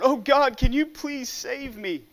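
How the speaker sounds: desperate plea. A narrator's reading. Man dangling from the cliff begs God, desperate and high. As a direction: beg for rescue from the branch. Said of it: "cries out".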